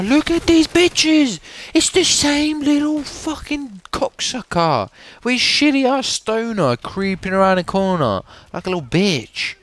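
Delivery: shrill outraged squeal at the enemy; high-pitched rant. Real, not acted. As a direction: rage at the camper in a high squeaky voice. Raging at enemy camper.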